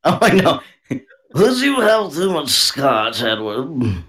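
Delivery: hammed-up toff teasing a drunk; mock posh scolding. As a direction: chide like a snooty club member. Posh mock voices banter.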